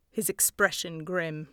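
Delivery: plain reading voice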